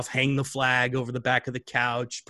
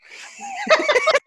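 high laugh; 7 dB louder than usual. Real, not acted. Laughing at own story.

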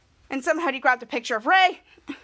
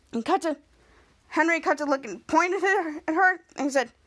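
usual speaking voice

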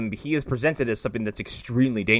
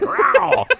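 cat yowl; 5 dB louder than usual. Retold or imitated. Imitating cat claw swipe.

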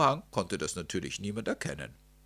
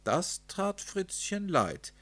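plain reading voice

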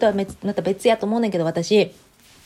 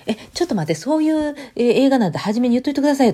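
reacting in startled protest; flustered surprise; 7 semitones higher than usual. Retelling her own reaction to an unexpected scene, flustered. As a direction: caught off guard, voice jumping up.

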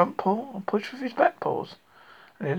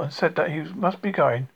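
usual speaking voice

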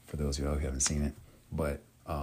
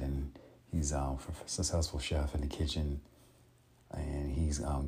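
ordinary delivery

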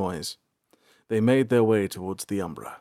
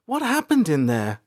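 put-on higher, louder girl's voice; April worried; 13 semitones higher, 4 dB louder than usual. A narrator's reading. April asking shaken Salanth what happened, worried and high. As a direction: ask anxiously. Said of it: "April asked".

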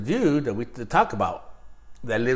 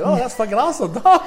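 high, laughing exclamation; a laughing, hyped-up shout of approval; mock excited praise; 11 semitones higher, 8 dB louder than usual. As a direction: gush about it through laughter.

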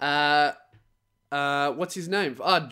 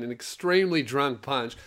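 ordinary delivery

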